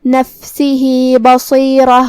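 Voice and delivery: بصیرہ with a full, heavy saad and ra; slow recitation, mouth rounded on the saad of بصیرہ. Shown as a demonstration. Teacher shows heavy saad and ra in بصیرہ. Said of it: "منہ پھلا کے بھاری آواز میں".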